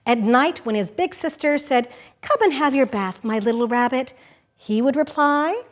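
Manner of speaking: lively picture-book reading; reading to children. Acted. Host reading Pooh Bum aloud on the radio, playful storytelling. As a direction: read it like story time for kids.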